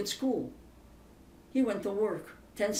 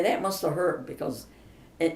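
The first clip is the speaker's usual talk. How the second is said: ordinary talk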